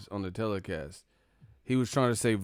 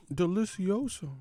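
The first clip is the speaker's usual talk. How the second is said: high, hammed-up Spanish-flavoured praise; mock Spanish gourmet voice; mock Spanish relish; 9 semitones higher than usual.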